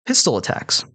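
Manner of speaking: ordinary delivery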